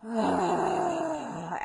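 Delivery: a long, crying sigh; sighing exhale. Shown as a demonstration. Coach exhaling with a sigh while tapping. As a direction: sigh it out like a sob. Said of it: "Exhale with a sigh".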